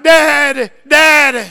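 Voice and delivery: put-on baby calling daddy. Baby crying for daddy in playpen.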